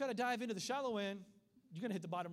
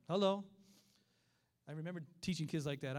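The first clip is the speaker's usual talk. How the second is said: usual speaking voice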